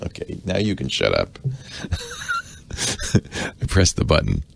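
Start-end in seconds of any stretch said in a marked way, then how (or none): 1.4-2.4 high laugh. Real, not acted. Laughs after shut up.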